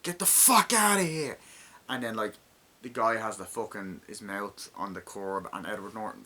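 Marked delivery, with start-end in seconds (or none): 0.0-1.4 loud, high furious yell; angry shout. Retold or imitated. Norton yelling at the man he shoots, shouting furiously. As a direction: roar it at the intruder, high and loud. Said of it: "and he's like".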